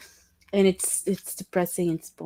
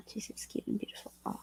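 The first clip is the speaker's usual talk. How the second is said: whisper; 19 dB quieter, faster than usual. Real, not acted. Aside about someone in chat.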